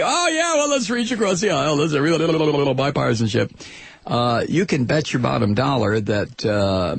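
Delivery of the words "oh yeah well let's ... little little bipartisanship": high, fast, singsong mock of a conciliatory politician; mocking Romney. Host mocking Romney's concession speech, high fast mock voice. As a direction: sneer through the fake niceness, quick and pitched up. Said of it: "but now in the concession speech".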